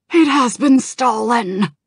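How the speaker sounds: loud, high, slowed wail; anguished cry. Acted. Naragos wailing to Kalik about the theft, anguished. Panicked dragon.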